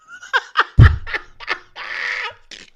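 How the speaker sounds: long, high-pitched helpless laugh; real high cackling laughter; laughing fit. Real, not acted. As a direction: burst into a loud, drawn-out laughing fit. Cracking up at a chat message.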